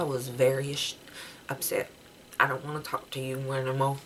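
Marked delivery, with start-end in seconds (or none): none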